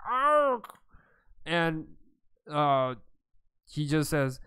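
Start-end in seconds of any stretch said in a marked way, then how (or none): 0.1-0.8 loud, high monster cry; creature yell. Acted. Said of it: "the creature just yells".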